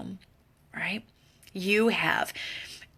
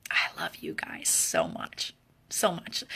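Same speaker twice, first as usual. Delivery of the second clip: tender farewell; 4 semitones lower, 6 dB quieter, slower than usual. Real, not acted.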